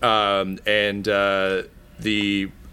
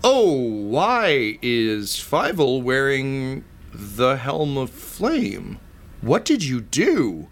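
very slow drawl with big pitch swoops; haughty questioning villain; 4 semitones higher, slower than usual. Acted. Cinder questioning the helm's wearer, slow and swooping. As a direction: draw out each word, swooping up and down. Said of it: "who's like".

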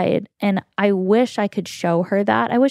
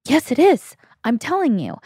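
insistent retort; 5 semitones higher than usual. Retold or imitated. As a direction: insist she's right, emphatic. Insisting to her sister.

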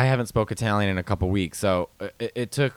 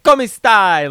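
high, loud Italian exclamation; big mock-Italian hello; imitated Italian greeting; 9 semitones higher, 9 dB louder than usual. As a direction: belt it out in hammed-up Italian. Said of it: "he'll say".

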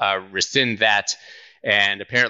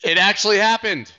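surprised; 11 semitones higher, 4 dB louder than usual. Real, not acted. Lawsuit win just announced.

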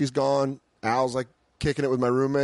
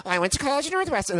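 put-on girly voice; 12 semitones higher, faster than usual. Imitating the girl bragging.

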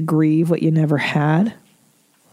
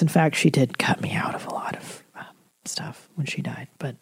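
hushed aside; 3 semitones lower, 8 dB quieter than usual. Real, not acted. Host confiding her own mother cut her out, whispered. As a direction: drop to a near whisper, much softer.

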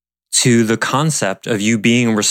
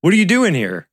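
imitating an indignant retort, higher pitched; mock defensive; 7 semitones higher than usual. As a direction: bristle as if someone crossed a line, raised pitch. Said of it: "but that's the energy".

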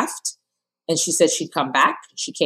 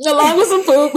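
laughing high and loud; 12 semitones higher, 3 dB louder than usual. Real, not acted. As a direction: burst out laughing while repeating. Laughing repeat of host line.

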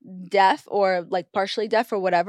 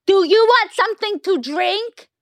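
high, over-enunciated flight attendant call; mock her talking loudly to someone deaf; imitated loud, slow-witted talk; 8 semitones higher, 4 dB louder than usual. Passenger imitates stewardess talking to her as deaf, loud and high. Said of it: "Like, so loud".

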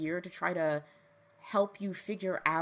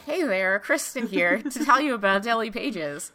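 loud, laughing TV pitchman greeting; 3 semitones higher, 7 dB louder than usual. Retold or imitated. A host pitching her next episode, loud and laughing. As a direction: sell it like an infomercial. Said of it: "Billy Mays here".

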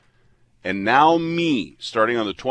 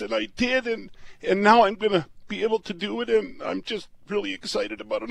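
higher, softer, choking up with real feeling; getting emotional; 8 semitones higher, 3 dB quieter than usual. Real, not acted. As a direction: let the emotion catch your voice as you thank your wife. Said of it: "I'm sorry I'm getting emotional".

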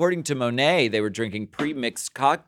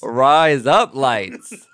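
loud, slow mock Australian "Rise up lights"; mock Aussie; 3 semitones higher, 6 dB louder, much slower than usual. Mocking Aussie phrase, loudly.

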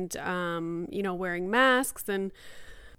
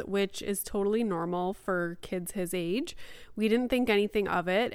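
ordinary delivery

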